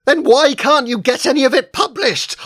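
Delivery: high, loud, desperate cry; almost scream the question at her; near scream. Acted. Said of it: "I almost screamed".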